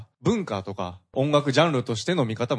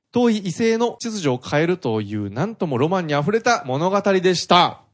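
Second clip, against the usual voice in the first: raised, projected radio reading voice; listener mail read-out; 5 semitones higher, 5 dB louder than usual. Acted. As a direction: read the review out loud, higher and firmly projected. Reads out a listener's email.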